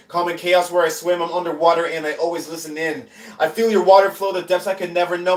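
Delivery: spoken-word poem. Acted. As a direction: perform it as a driving chant-like poem, easing off at the end. When I actually perform the poem.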